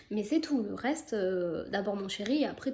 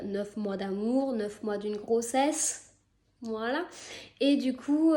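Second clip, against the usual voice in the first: ordinary talk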